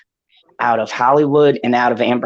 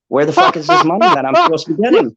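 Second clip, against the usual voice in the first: higher, fast mock-indignant outburst; exasperated outburst; 11 semitones higher, much faster than usual. Host answering commenters who say he does it for money, indignant. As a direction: fire back, incredulous and fast.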